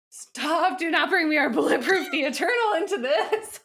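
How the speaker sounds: high, loud, laughing outcry; mock protest. Real, not acted. Host begging co-host not to bring up a song, mock outraged. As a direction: wail in playful protest, breaking into laughter.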